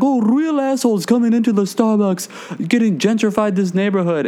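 high-pitched mock ranting; imitated angry complaint. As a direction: rant high, as the ousted regular. Retold Russian guy complains while kicked out.

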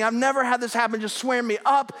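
usual speaking voice

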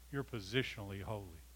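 low, hushed sermon delivery with long pauses; solemn preaching. Real, not acted. Preacher explaining progressive holiness to the church, solemn. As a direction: bring it down low, one weighed phrase at a time. Measured, lowered teaching passage of sermon.

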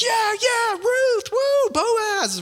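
very high whooping cheer; cheering crowd. Retold or imitated. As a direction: celebrate like a crowd at a movie finale. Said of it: "Everybody's like".

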